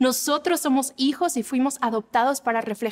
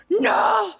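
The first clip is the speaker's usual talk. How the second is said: high shouted "No!"; shouted denial from someone red with rage; mock angry denial; 9 semitones higher than usual. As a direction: snap back, fuming but denying it. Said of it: "gente que nunca se enoja, que está así".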